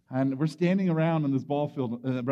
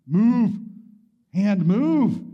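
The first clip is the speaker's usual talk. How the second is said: pushing himself on; 3 semitones higher, 6 dB louder, much slower than usual. Retold or imitated. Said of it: "I was just going".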